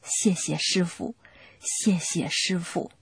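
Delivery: soft, slow, murmured under the breath; a hushed, slow thank-you to oneself; silent thanks. Retold or imitated. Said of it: "心里默默地说". (轻声缓慢地默念)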